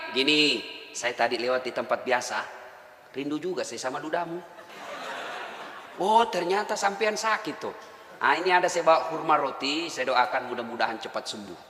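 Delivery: hushed warm get-well words with a chuckle; tender visit. Acted. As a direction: comfort a sick man gently. Prophet tenderly visits sick man.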